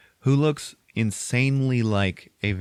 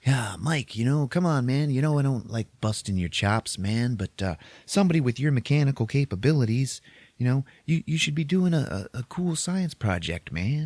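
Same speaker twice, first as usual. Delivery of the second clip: high-pitched, rambling ex-hippie teacher; Dennis Hopper impression; 6 semitones higher than usual. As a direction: coax the kid like an old hippie. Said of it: "So then Dennis Hopper's like".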